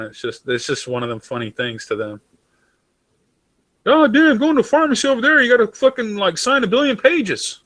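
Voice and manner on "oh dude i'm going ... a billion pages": mock whiny American. Mocking an American complaining about pharmacy forms, high and whiny. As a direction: whine about paperwork like a caricature.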